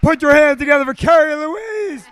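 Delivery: loud, high show-host shout; MC applause call. Real, not acted. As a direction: whip up applause for the act.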